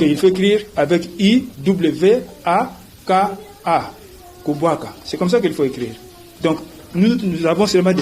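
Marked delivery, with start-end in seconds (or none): none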